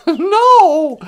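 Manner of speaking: a loud no to going to see Cats; loud refusal. Real, not acted. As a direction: shut the idea down hard.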